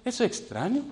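hushed, puzzled inner voice; thinking to himself. Retold or imitated. Newcomer puzzled that nobody volunteers, thinking softly. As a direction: mutter the thought under your breath. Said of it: "yo mismo pensando".

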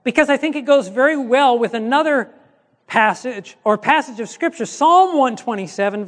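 ordinary talk, nothing marked

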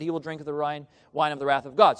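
usual speaking voice

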